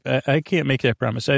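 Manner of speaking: ordinary talk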